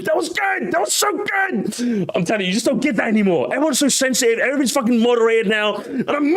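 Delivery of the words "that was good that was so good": high, drawn-out delight; blissful high-pitched reminiscing; gushing nostalgia. Real, not acted. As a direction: savour it in a high, slow wail. Gushing over old lobby banter.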